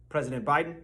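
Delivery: usual speaking voice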